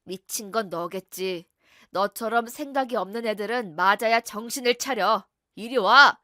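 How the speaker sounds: loud, menacing husband barking a dare; angry husband. Acted. Abusive husband bullying his wife, loud threat. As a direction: dare her to talk back, loud and hostile.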